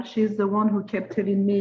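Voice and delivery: normal talking voice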